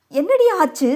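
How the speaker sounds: high-pitched, anxious questioning; worried elder woman demanding to know; alarmed. A narrator's reading. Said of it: "வயிற்றில் புலியை கரைத்தது".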